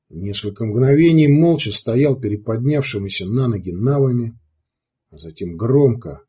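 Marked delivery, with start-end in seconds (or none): none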